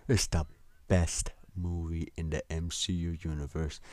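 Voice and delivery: gushing mock fan voice; overhyping fan. Podcaster mocking hyped fans, gushing voice.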